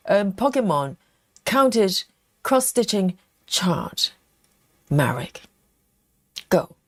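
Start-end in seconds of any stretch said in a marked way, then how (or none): 4.9-6.7 whispering. Real, not acted. Prompting the baby to answer.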